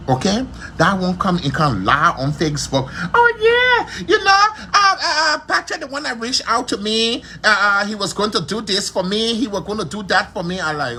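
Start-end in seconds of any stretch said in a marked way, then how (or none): 3.1-10.6 high, whiny mock voice with a crying edge; mocking whiny quote. Mocking Karishma's Facebook story in a high whiny falsetto. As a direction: parrot him in a squeaky falsetto whine.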